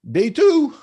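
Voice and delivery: cheeky, gleeful declaration of a day off; triumphant punchline. Retold or imitated. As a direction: announce Day two like a victory.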